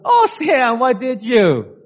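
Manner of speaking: high, delighted oh Sam; surprised. Retold or imitated. Wife at the door surprised by flowers. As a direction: gasp it with delight.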